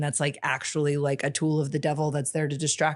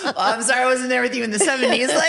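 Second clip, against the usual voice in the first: fast and higher, still laughing; 9 semitones higher, 6 dB louder, faster than usual. Real, not acted. Guest joking with the host, laughing. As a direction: quip it back through the giggles.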